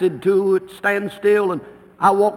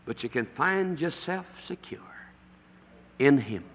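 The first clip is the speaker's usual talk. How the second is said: breathy hushed whisper; secret-sharing whisper; 5 semitones lower, 7 dB quieter than usual. Real, not acted. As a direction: drop to an intimate whisper. Tender hush about God's refuge.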